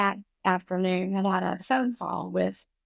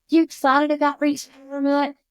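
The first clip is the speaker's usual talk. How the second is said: higher, louder mock-bright greeting from a parent; mock cheerful parent; 6 semitones higher, 7 dB louder than usual. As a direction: imitate a chirpy parent asking about retirement. Parent greets her, mimicked.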